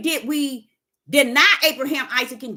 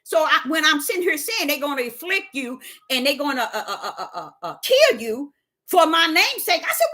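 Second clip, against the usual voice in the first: normal talking voice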